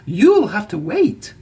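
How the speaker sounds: a proud engine huffily refusing his friend; huffy. A narrator's reading. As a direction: brush him off with a huff. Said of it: "huffed Thomas".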